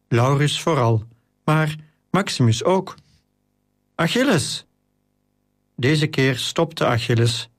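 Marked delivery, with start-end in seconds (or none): 4.0-4.7 lightly voiced call, high pitch falling away. A narrator's reading. Friend calls Achilles in the crowd, a restrained high call. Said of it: "iemand zijn naam riep".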